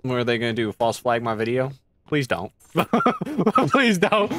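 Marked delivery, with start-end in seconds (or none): 2.7-4.3 pleading through a laugh. Acted. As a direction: beg while laughing, higher. Repeats plea while laughing.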